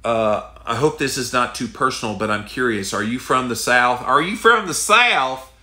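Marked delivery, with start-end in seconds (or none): 4.0-5.5 Southern drawl, much higher and louder, slow; mock-incredulous Southern drawl; exaggerated Southern drawl. Shown as a demonstration. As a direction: ham up the Southern accent on "Are you from the South?". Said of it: "somebody that talks like this".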